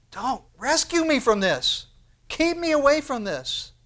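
acted plea, pitched well up and insistent; urgent plea. Preacher voicing Paul's prayer to God, urgent plea.

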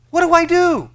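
high, loud cry of What do I do; hammed-up plea, high and loud; mock shout. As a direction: cry it out as the seeker.

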